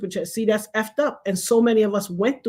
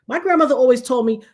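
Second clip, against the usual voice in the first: ordinary talk, nothing marked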